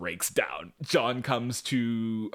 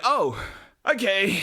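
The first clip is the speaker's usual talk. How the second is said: mock surprised oh okay; 10 semitones higher, 4 dB louder than usual. Sarcastic reaction quote.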